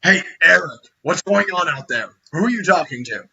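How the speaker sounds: shouted question to someone out of view; yelling. Acted. Orc calls to a comrade outside, yelling. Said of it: "you actually hear someone yell".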